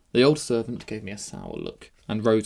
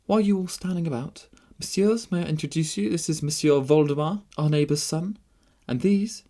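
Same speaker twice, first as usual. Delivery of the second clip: high, louder hostess voice; lively young princess; 6 semitones higher, 5 dB louder than usual. A narrator's reading. Princess introducing the new guest to company, lively. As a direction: introduce with high, playful authority.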